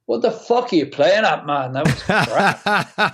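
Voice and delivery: hammed-up attempt at the accent, no filter; rough imitation of the accent, telling a player off; Bob's blunt accent. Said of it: "I can't do the accent properly".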